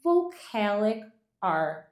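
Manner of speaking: vocalic R said very slowly in English; slow careful English vocalic R; English term. Shown as a demonstration. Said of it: "o nome disso é".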